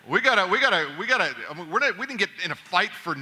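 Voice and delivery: ordinary talk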